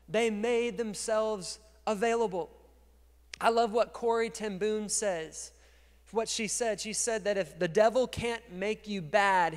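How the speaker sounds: normal talking voice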